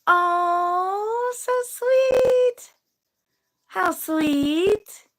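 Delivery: squeaky high coo over sweet comments; high gushing coo. Real, not acted. Coos at a viewer's sweet comment, high and gushing. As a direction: gush with delight.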